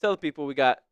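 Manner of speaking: ordinary talk, nothing marked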